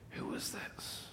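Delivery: hushed whispered question; awed whisper. Retold or imitated. Disciples whispering to each other after the storm, awed. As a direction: whisper it in awe. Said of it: "internal dialogue among the disciples".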